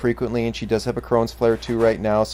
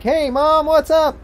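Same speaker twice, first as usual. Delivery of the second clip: put-on much higher, chirpy kid voice; daughter's voice; 5 dB louder, slower than usual. Daughter waking her mother at six thirty, in a high chirpy kid voice. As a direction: chirp it like a cheerful kid at dawn. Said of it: "is like".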